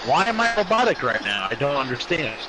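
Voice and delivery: ordinary delivery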